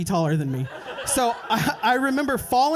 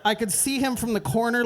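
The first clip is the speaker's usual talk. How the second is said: ordinary delivery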